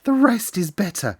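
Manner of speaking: eager solemn reveal; solemn excitement. Acted. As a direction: build the secret. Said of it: "almost pale with solemn excitement".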